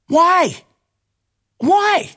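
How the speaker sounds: exasperated why. Real, not acted. Ranting vlogger fed up with pricey fake meat, exasperated shout. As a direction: throw the question up in outrage.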